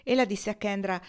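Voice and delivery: plain reading voice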